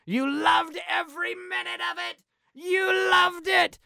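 high, loud, desperate yell; psychotic yell. Retold or imitated. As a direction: scream it at her, sure of it. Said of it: "He is convinced".

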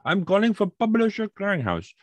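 high-pitched mock Jamaican caller; heavy Jamaican accent. Caller repeating himself to a baffled man, heavy Jamaican accent. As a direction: imitate a Jamaican telemarketer insisting. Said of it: "Very heavy Jamaican accent".

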